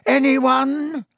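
high taunting question; mocking. A narrator's reading. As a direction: sing it out with scorn.

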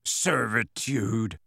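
slow, drawn-out scornful echo; scathing. A narrator's reading. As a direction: stretch the word out with scorn. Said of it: "said Hagrid scathingly".

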